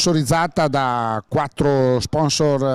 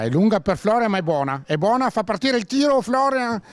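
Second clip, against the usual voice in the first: excited attack call; 8 semitones higher than usual. Real, not acted. Commentator follows Florian through to the shot, excited. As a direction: pitch climbing high as the chance opens up.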